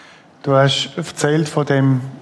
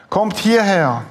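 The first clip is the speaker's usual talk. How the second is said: put-on higher call to the kids; 5 semitones higher, slower than usual. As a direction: call them over to you. Calling children toward cell window.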